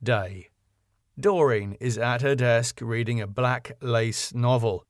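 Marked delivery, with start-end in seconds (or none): none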